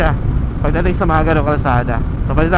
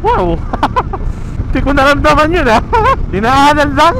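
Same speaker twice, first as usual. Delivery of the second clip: excited; 9 semitones higher, 3 dB louder than usual. Real, not acted. Excited rider on smooth ride.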